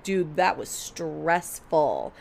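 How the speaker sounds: drawn out slowly and tired; slow weary sigh. Retold or imitated. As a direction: let it sag out, exhausted. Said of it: "I was like".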